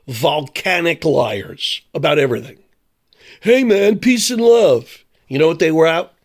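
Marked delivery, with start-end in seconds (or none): 3.4-5.0 mock hippie. Mocking hippies' slogan.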